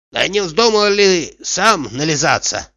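slow, knowing suggestion; witty guess. A narrator's reading. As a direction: draw out a sly guess at the master's motive. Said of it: "остроумно догадался Артемий".

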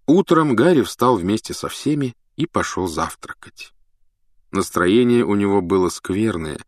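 plain reading voice

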